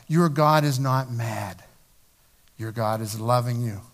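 tender reassurance. Acted. Pastor assures the guilty people, low and tender. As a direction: soothe them gently.

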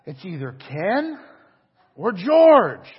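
rising to a loud squeal on George; mock giddy whisper-to-squeal; excited girls. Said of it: "and they're like".

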